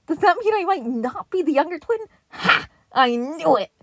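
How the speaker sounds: high, loud gloating; teen turtle celebrating a win; triumph. A narrator's reading. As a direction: crow with delight.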